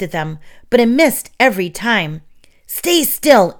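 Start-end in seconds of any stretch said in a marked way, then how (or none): 2.7-3.5 higher, louder spider command; the spider orders the boys to stop, hissing; hissed command. A narrator's reading. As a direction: hiss at the bouncing boys. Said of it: "it hissed".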